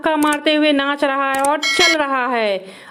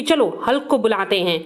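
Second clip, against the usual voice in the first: ordinary delivery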